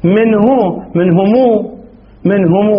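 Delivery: "منه" ends in long -huu, "منهم" in long -humuu; lengthened pronoun endings. Shown as a demonstration. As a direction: draw out the final u on "منه" and "منهم". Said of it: "هكذا بهذا الشكل يعني".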